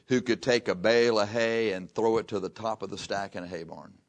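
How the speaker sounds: ordinary talk, nothing marked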